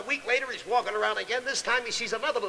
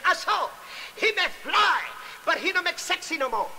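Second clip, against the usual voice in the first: mock Japanese accent; 6 semitones higher than usual. Japanese swordsman shouts punchline.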